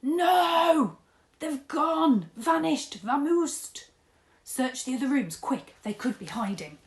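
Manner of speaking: high, loud frantic cries; panicked. Acted. Dad panicking on finding Granny's room empty. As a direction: shriek in alarm then order a search.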